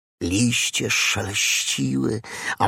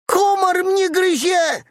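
very high loud scream, komar stressed; piglet screaming; 4 dB louder than usual. A narrator's reading. Piglet bursting into the fox's den, very high scream. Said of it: "wrzasnął prosiaczek".

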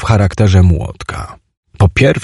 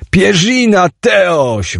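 pained yell; 9 semitones higher, much slower than usual. Acted. Chimek cries out to Teoś over his hurt hand, high yell. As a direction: yelp out in protest. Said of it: "wrzasnął Chimek".

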